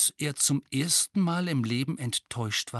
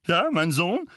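loud hearty bluster; father loudly praises his son; 5 semitones higher, 5 dB louder than usual. A narrator's reading. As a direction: steer the talk loudly. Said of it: "sagte Onkel Vernon laut".

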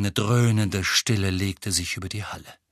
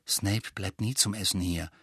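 softer, lower confiding voice; 4 semitones lower, 6 dB quieter than usual. A narrator's reading. Ron filling Harry in on Snape, in a low voice. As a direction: explain it quietly, keeping it down. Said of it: "mit leiser Stimme".

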